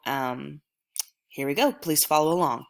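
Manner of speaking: normal talking voice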